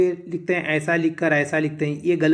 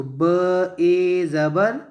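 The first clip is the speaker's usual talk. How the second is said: very slow, drawn-out letter names; spelling out; much slower than usual. Shown as a demonstration. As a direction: stretch each sound for learners. Teacher demonstrates spelling out letters.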